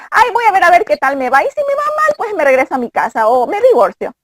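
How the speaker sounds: mocking carefree woman. Imitating older women to Dayana, airy high mock voice. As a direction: lampoon a grown woman's casual attitude. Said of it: "que también dicen".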